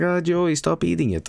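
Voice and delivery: high whiny imitation of a crying viewer; mock crying plea. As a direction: mimic someone whimpering and begging him to stop. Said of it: "gonna be crying and being like".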